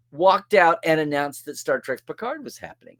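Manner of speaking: usual speaking voice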